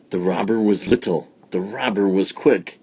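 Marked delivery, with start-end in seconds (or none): none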